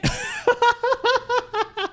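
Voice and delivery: high giggling laugh. Real, not acted. Laughs after own outburst.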